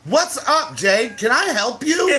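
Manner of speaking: high, loud sassy challenge; mock sass. Him snapping back at the snitching friend, sassy and loud. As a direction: confront her with playful attitude. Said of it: "I was like".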